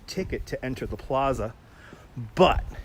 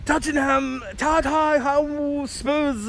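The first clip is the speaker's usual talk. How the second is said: drawn-out, much higher announcer call of the team; mock sports announcer; 12 semitones higher, 4 dB louder, much slower than usual. Streamer mocks an announcer for a viewer: high, stretched stadium call. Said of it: "isn't he your greatest announcer ever".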